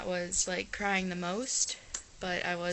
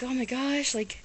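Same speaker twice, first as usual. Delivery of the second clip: surprised classmates; 4 semitones higher, 4 dB louder, slower than usual. Retold or imitated. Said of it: "they were like".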